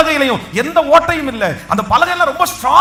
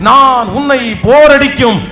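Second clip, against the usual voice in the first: loud, lower proclaiming voice, slowing; full-force preaching; 4 semitones lower, 6 dB louder than usual. Acted. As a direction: proclaim it loudly over the crowd. Preacher proclaims strength, claps. (உரத்த குரலில் ஆவேச பிரசங்கம்)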